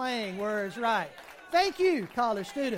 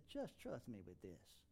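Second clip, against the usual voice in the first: still small voice; 14 semitones lower, 18 dB quieter, slower than usual. Retold or imitated. Still voice speaks from God.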